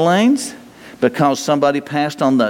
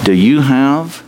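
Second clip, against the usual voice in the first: sudden loud question; 6 dB louder than usual. Real, not acted.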